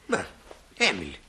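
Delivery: low, soft and kindly; gentle concern. Acted. Shopkeeper gently asks the tearful girl, low and soft. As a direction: soothe the upset girl.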